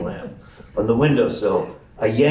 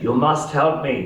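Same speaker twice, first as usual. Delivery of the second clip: put-on intense, higher German-accented plea; Colonel Klink; 4 semitones higher than usual. Old man gripping a boy's arm, intense German accent. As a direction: plead slowly in a thick German accent. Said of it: "spoke in a thick German accent".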